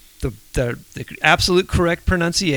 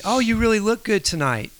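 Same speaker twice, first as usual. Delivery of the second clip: gushing praise; 7 semitones higher than usual. Retold or imitated. Imitating kind talent-show judges' praise.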